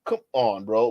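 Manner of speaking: usual speaking voice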